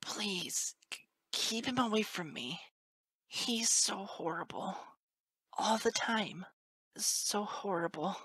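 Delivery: high, whispery frightened colt; tearful plea. A narrator's reading. Colt clinging to teacher for protection, high frightened plea. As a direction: plead haltingly in a small high voice.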